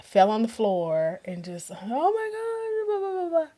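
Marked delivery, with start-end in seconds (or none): 1.7-3.5 mock sobbing wail. On the bathroom floor, a high tearful wail. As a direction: wail as if collapsing in tears.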